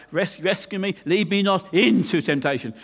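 "into" hit hard and loud; stress on INTO. Shown as a demonstration. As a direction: punch the first syllable of "into". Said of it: "You have to stress the word into".

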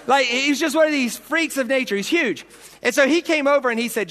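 normal talking voice

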